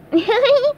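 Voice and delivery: high silly chuckle; high giggle. Acted. SpongeBob laughs off the soufflé question with a high giggle. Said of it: "SpongeBob laughed".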